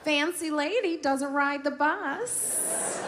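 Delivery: high, slow sneering lady voice; mocking Wisconsin lady. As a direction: drag out fancy in a high mock-sneer. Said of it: "One lady, she was like".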